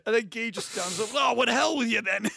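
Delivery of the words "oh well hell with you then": loud, raised mock huff; imitating Guy fed up. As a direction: throw out a mock-angry parting shot, loudly. Guy storms off, acted.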